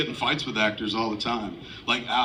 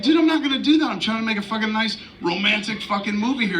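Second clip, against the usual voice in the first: high, raised mock protest; imitating own exasperation; 8 semitones higher than usual. Director retells arguing with the actor, high-pitched mock outrage. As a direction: replay your old outrage, half hammed-up.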